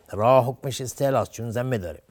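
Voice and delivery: ordinary delivery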